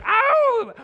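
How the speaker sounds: high, loud "oh"; loud whoop. Real, not acted. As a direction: let out a sudden high whoop. Sudden whoop during joking aside.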